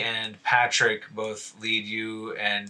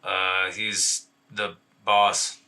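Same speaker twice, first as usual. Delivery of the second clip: slow, halting, unsure answer; confused henchman, lower and very slow; 4 semitones lower, much slower than usual. Acted. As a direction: hesitate and drag the words out, lower. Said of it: "looks confused".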